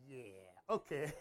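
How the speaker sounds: slow, laughing, caught-out agreement; sheepish laugh. Retold or imitated. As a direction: concede with an embarrassed laugh. Caught out, answering God.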